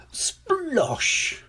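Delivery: high-pitched imitated splosh; splash noise. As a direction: voice a big splash, drawing it out. Landing in the puddle.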